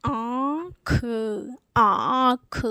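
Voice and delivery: आँख with long nasal आँ, separate ख; nasalised vowel demo. Shown as a demonstration. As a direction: stretch आँख through mouth and nose. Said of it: "ये क्या उच्चारित होगा?".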